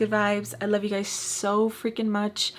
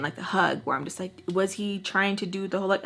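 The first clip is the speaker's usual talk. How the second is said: ordinary talk, nothing marked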